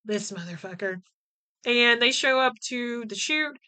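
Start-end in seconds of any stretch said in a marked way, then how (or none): 0.1-1.0 exasperated eye-roll. Retold or imitated. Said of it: "Shay rolls her eyes".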